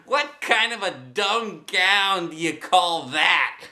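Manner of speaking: a bully mocking a gown; mean boy. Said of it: "said Meanie Jim".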